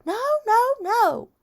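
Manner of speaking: put-on very high toddler tantrum scream. A narrator's reading. Boy fighting the shoe salesman, high screaming. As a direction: scream it like a small child refusing. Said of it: "He screamed".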